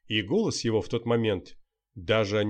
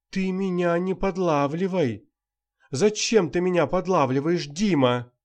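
higher and louder, offended and pleading; hurt and threatening; 7 semitones higher, 5 dB louder than usual. A narrator's reading. Actress accusing the journalist of trying to trap her, offended. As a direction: accuse him, wounded, begging pity. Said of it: "обида, и угроза, и призыв к жалости".

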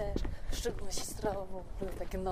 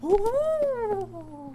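spooky ghost hoot; 12 semitones higher, 9 dB louder than usual. Pretend. Playful ghost sound to child.

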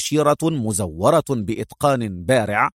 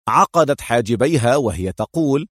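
usual speaking voice

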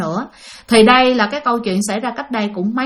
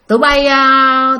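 drawn-out call; 5 dB louder, slower than usual. Retold or imitated. Girl calls friends, drawn out.

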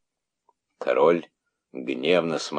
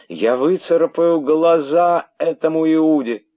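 very high, loud widow's cry; frantic shriek; 11 semitones higher, 7 dB louder than usual. A narrator's reading. Widow threatening the traitor's eyes, frantic shriek. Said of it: "истошный крик вдовы".

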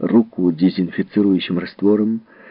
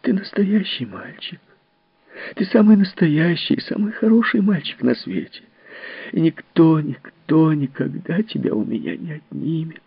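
higher, a touch of sobbing comfort; lightly tearful, soothing a crying child; tearful reassurance; 7 semitones higher than usual. Acted. Miss Fellowes comforts Timmy, higher and a touch tearful. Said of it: "всхлипывая, сказала мисс Фэллоуз".

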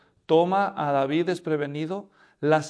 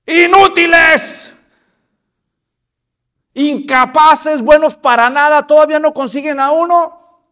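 high, very loud contemptuous bellow; giant's roar; 9 semitones higher, 13 dB louder than usual. Acted. Goliath bellowing insults at the Israelite army. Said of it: "se oye un".

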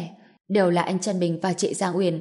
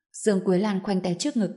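ordinary delivery

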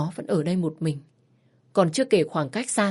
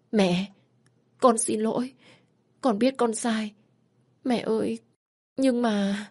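tearful apology; 5 semitones higher, slower than usual. Acted. Daughter apologizing to her mother, higher and tearful. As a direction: apologize through tears.

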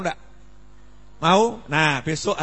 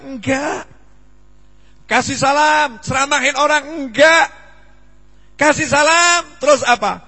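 building preaching shout; 8 semitones higher, 8 dB louder, slower than usual. Real, not acted.

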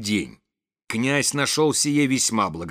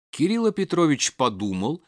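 plain reading voice

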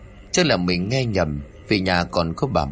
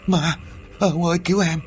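terrified scream; 9 semitones higher than usual. A narrator's reading. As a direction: scream for help waking from a nightmare. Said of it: "bật dậy hét toáng lên".

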